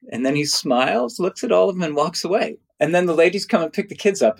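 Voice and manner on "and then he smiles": ordinary delivery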